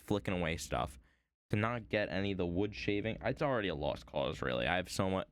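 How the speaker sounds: ordinary delivery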